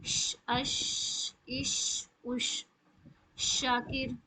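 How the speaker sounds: اش held as a long hissing ش with air spreading; airy drawn-out ش; spread sh sound. Shown as a demonstration. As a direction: stretch the ش in اش and شاکر. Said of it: "اس طریقے سے".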